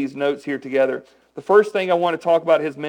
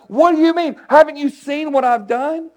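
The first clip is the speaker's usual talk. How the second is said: much higher, louder exasperated holler; mock yelling; 10 semitones higher, 4 dB louder than usual. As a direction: holler it at the disciples in disbelief. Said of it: "I'd probably start yelling and hollering like".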